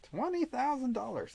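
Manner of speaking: high, stunned disbelief at the sum; surprised. Real, not acted. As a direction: gasp it out in disbelief. Reads the prize amount.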